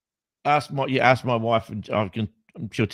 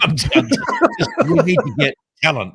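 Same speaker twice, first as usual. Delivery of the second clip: laughing; 8 semitones higher, 7 dB louder than usual. Real, not acted. Mocking the phrase with laughter.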